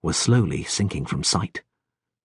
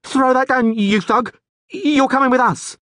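much higher, loud, panicky yell; trembling yell; 4 dB louder than usual. Acted. Frightened guard orders the Rivian, trembling yell. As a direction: yell it, voice shaking with fear. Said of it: "yelled with a trembling voice".

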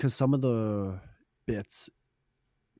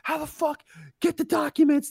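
high, frantic businessman yelling into a phone; mock freak-out on phone; 5 dB louder than usual. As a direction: panic at the caller, hammed up. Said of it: "freaking out ... on the phone".

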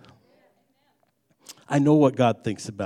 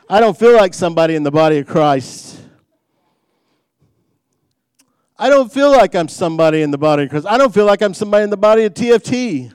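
imitating a discouraged churchgoer; 5 semitones higher, 8 dB louder than usual. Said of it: "I can hear someone say that".

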